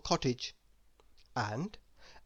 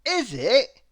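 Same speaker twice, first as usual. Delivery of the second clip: higher, louder teasing question; chuckling; 8 semitones higher, 9 dB louder than usual. A narrator's reading. Mister Happy teases Miss Giggles, chuckling. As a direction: chuckle it knowingly. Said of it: "chuckled Mister Happy".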